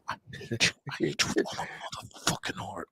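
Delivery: voiceless, soft seething; mock hatred. Real, not acted. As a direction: whisper it with exaggerated loathing. Mock hatred after being teased.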